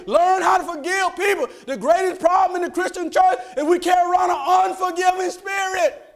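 high, loud exhortation; full-force preaching. Acted. Preacher urging Christians to forgive, high shouted exhortation. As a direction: hammer each command out to the congregation.

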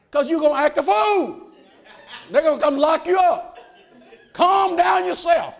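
loud, high preaching push, the congregation rising with him; fiery preaching. Acted. Preacher urging the church to let it go, full-force preaching. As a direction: drive it home like a revival preacher.

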